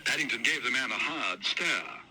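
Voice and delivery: usual speaking voice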